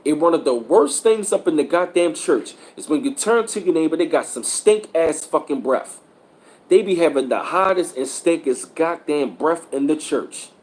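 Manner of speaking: usual speaking voice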